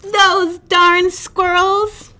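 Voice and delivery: put-on grumpy old man shouting slowly at squirrels; old man yelling. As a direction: shake a fist and drag out the curse. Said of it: "shook his old man fist and yelled".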